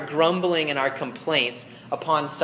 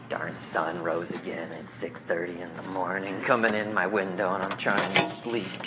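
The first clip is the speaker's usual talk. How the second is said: low, sulky mumbled complaint; grumbling teen; 6 semitones lower, 5 dB quieter than usual. Pretend. Preacher voicing a teen grumbling about the sunrise, low and sulky. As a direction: grumble it like a sleepy teenager.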